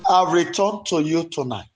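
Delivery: passionate repentance prayer that swells into shouts; fervent prayer. Shown as a demonstration. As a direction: cry out to God for pardon, building and falling. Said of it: "Go ahead and pray".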